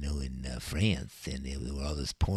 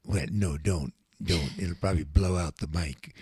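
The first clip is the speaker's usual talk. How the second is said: ordinary talk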